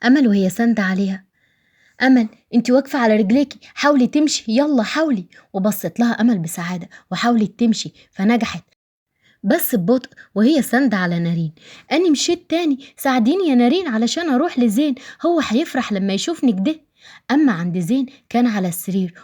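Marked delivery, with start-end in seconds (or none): 2.0-5.2 high-pitched, eager urging; excited encouragement. Acted. Woman urging Amal to try walking, excited higher voice. 11.9-16.8 high-pitched, joyful exclaiming; delighted. Acted. Amal telling her helper she walked, delighted high voice.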